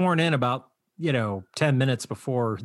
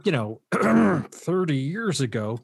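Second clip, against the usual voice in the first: normal talking voice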